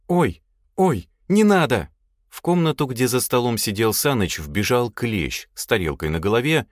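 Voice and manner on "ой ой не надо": high, louder scared yelp; frightened cry. A narrator's reading. Lad cries from the duty room, frightened. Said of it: "раздался испуганный крик".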